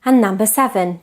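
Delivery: ordinary delivery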